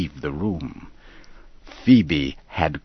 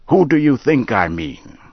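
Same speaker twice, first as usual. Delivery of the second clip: sharp; 7 semitones higher, 4 dB louder than usual. A narrator's reading. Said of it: "Henry said sharply".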